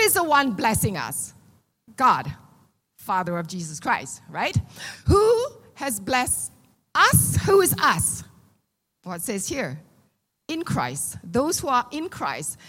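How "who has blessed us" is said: ordinary talk, nothing marked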